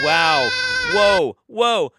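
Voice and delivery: loud stunned gasp of disbelief; shocked. Real, not acted. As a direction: blurt it out in real shock. Shocked reaction to exorcism clip.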